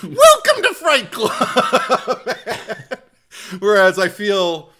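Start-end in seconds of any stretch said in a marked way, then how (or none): 0.0-2.3 much higher, louder, slow host shout; squeaky, over-eager show welcome; high-pitched imitation. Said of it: "your voice is even higher".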